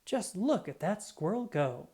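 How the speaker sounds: plain reading voice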